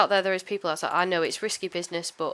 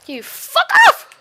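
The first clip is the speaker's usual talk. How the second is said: furious high-pitched shout; raging gamer; 15 dB louder, much slower than usual. Real, not acted. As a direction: yell at the mob, totally fed up. Raging at mob while gaming.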